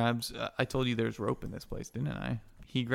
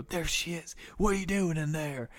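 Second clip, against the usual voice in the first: sailors calling; 5 semitones higher than usual. Acted. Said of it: "they go".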